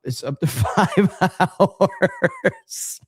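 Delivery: slow, laughing through the words; laughing while reading. Real, not acted. Streamer reading a funny chat, laughing. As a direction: break into a laugh mid-line.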